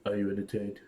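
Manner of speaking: low, soft mock politician; understated impression, voice dropped; Donald Trump. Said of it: "how would Donald Trump say".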